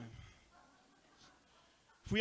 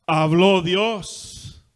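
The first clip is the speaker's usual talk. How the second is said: loud, very slow habló Dios; booming refrain; 16 dB louder, much slower than usual. Retold or imitated. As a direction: proclaim it like the old preacher. Preacher's refrain in sermon retelling.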